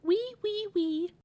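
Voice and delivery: high squeaky piglet chuckle; chuckling little pig. Acted. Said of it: "and he chuckled".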